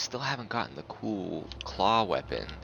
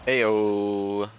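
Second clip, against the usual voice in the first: loud, happy little cheer; excited cheer; 3 semitones higher, 9 dB louder than usual. Real, not acted. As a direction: celebrate the level up out loud. Celebrating a level up.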